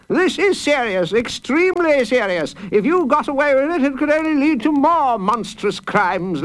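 high-pitched, blustering judge's growl; pompous judge reading out grave charges, pitched high. A narrator's reading. Judge charging a child with monstrous crimes, growling. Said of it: "he growled".

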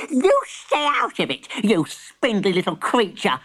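put-on high, sharp old granny; snapping granny. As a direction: snap at the spider. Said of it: "snapped Granny".

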